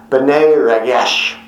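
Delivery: B'nai Ragesh said slowly with throaty Hebrew sounds; slow, guttural Hebrew B'nai Ragesh; Hebrew pronunciation. Shown as a demonstration. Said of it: "you can make those kind of noises".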